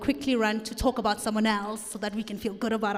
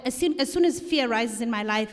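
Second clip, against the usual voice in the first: ordinary delivery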